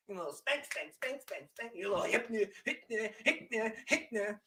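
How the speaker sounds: soft, sing-song mocking spank spank; mock taunt. Taunting Saints fans on stream, softly mocking. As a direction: tease them under your breath.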